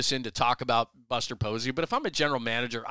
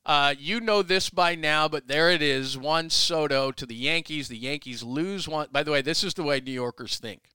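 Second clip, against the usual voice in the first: usual speaking voice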